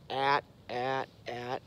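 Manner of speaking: "at, at, at" as short clipped duck quack syllables; duck call honks. Shown as a demonstration. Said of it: "You're gonna say at into the call".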